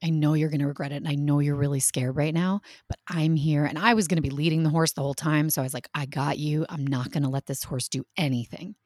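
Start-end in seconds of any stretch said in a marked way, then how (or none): 0.0-3.6 soft, low, steady coaxing; reassuring mother. Retold or imitated. Mom retelling coaxing her scared daughter, low and reassuring. 5.9-8.7 low, soft promise; quietly steady a frightened child; reassuring mother. Retold or imitated. Mom promising daughter safety on the horse, low and reassuring.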